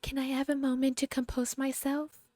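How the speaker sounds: high, soft, delicate mock mother voice; soft-spoken imitation. Mocking the mother from the film, high and soft. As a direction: mimic her fragile soft-spoken act. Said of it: "she's so soft-spoken".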